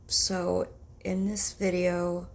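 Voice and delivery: normal talking voice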